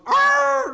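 high, loud mock dog growl. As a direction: bark it out like a grumpy dog. Dog's growl in story.

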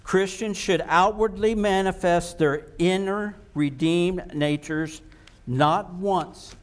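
ordinary talk, nothing marked

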